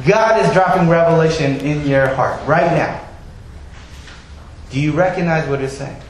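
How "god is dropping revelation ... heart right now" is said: usual speaking voice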